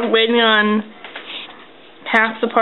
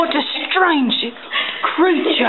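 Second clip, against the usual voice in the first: higher, marvelling mock-Australian narrator; bad Australian accent; 5 semitones higher than usual. Wildlife host amazed by the creature, bad Australian accent. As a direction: marvel in badly faked Australian. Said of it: "Your Australian accent is horrible".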